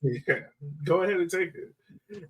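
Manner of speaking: ordinary talk, nothing marked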